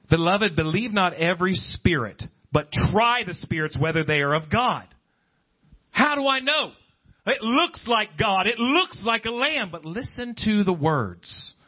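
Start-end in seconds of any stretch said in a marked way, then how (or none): none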